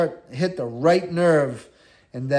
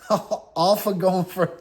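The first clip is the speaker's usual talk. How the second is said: laughing; 2 semitones higher, slower than usual. Real, not acted. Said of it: "it was hilarious".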